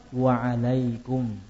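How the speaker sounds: slow quiet "Waalaikum"; calm reply. Retold or imitated. The Prophet answering the greeting, slow and calm. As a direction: answer briefly and calmly, slowly. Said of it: "Jawaban Nabi Muhammad".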